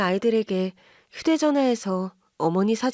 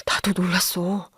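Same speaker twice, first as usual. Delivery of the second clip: whisper; 2 semitones lower than usual. Acted. Husband whispers in the dark.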